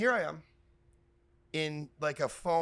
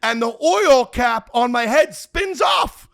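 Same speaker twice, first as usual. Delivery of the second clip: slow, loud, higher-pitched punchline build; loud comic climax; 4 semitones higher, 11 dB louder, slower than usual. Real, not acted. Comic telling how his costume got wrecked, loud climax. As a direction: boom out every beat of the mishap.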